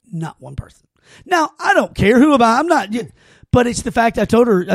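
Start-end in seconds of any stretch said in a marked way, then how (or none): none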